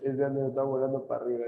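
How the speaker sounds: reading a chat line through a laugh; quick, amused read of a comment; chuckling as he reads it out; laughing quote. Retold or imitated.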